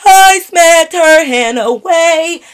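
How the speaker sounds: loud proud boast. Real, not acted. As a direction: boast it loudly and slowly. Proudly repeating her smack.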